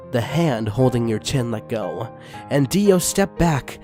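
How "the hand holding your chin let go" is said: usual speaking voice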